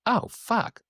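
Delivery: high-pitched "oh" of sudden understanding; imitated chastened surprise; dawning realization. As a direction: act out someone realizing his mistake. Retold man realizes his mistake.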